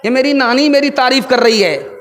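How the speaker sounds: ordinary talk, nothing marked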